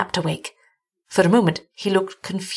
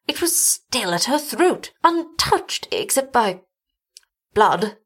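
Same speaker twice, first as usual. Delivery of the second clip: higher, slowing horrified voice; sickened; 5 semitones higher, slower than usual. A narrator's reading. Doctor describing his wounded wife's cross, sickened. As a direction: falter in horror toward the final word. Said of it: "he added in a sickened voice".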